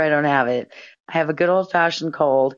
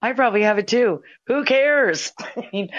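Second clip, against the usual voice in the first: laughing; 6 semitones higher than usual. Retold or imitated. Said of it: "And I started laughing".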